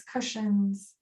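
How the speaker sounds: normal talking voice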